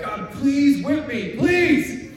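slowed, overblown cry of please; mock begging. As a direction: beg God like a melodramatic sinner. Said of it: "You're like".

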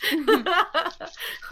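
high nervous giggle; nervous laughter. Real, not acted. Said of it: "maybe I'm laughing 'cause I'm nervous".

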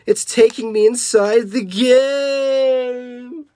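high, slow wail with a long held last word; high acted cry of alarm; panicked wail. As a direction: wail it as if being sucked away. Same screaming character continues.

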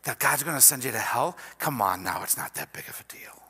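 soft, fast, sly serpent voice; quick, hushed coaxing that ends unvoiced; snaky voice. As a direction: coax like a tempting snake, fading into a whisper. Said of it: "the snaky voice comes in and says".

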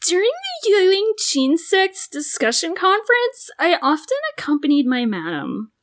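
put-on high, meek servant girl; maid voice. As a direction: speak as a timid maid. Voicing maid.

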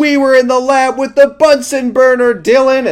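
high, loud imitated confession; loud yell. Recapper as Ben confessing the lab fire to Dylan, loudly yelling. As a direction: yell it out as if to someone far away. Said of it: "Ben loudly yells".

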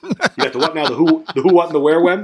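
hammed-up comic disbelief, building; mock bafflement. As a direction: play utterly confused, half laughing. Mock baffled reply acted out.

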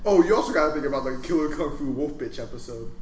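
normal talking voice

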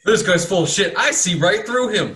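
mock the player's cryptic line. GM mocking the cleric's words, high and jeering.

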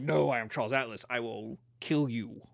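low menacing boast, dropping away at the end; Hulk-like strongman. Acted. Ripped former weakling to scared beachgoers, low mock menace. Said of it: "the Hulk".

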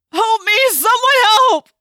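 high, loud desperate scream; yelping cry for help. A narrator's reading. Human about to be killed by predator, loud yelping cry. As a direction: shriek out for anyone to save you. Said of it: "Loud, yelping vocalizations".

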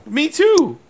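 high-pitched mock-dainty reply. Joking as the English interviewer answering the singer, high pitch. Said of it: "I just said it high pitch".

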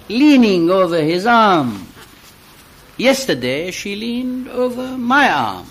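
recited dialogue between two friends; poem reading. Acted. Reader performs a poem of two friends, recital style. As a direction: read it as a staged exchange.

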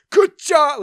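high, loud, botched character voice; half-remembered cartoon cat shout; Cat in the Hat. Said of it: "trying to put on the Cat in the Hat voice".

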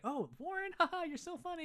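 very high, giggly fake laugh; put-on flirting. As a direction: gush over him for show, laughing too hard. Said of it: "puts it on hard".

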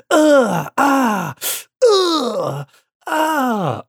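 squeaky high-pitched battle cries; high whiny fight grunts. Retold or imitated. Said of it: "annoying part of the show".

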